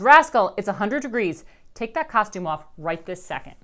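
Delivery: loud stern call; mom yells. A narrator's reading. Mom yells at Rascal about costume, loud stern. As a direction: call her from across the house. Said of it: "my mom yells".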